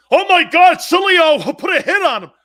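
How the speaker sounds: high, loud panicked voice; hammed-up shocked crowd; mock alarmed callers. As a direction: ham up the panic. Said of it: "mock quote of people".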